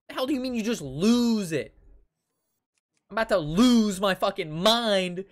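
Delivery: loud, high shouted outrage; yelling at a teacher over lost grades; furious rant. Retold or imitated. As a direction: blow up at the teacher.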